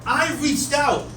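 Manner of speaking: high-pitched, pleading outburst; agitated protest. Acted. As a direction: insist on innocence, voice climbing. Suspect protests innocence, told sit down.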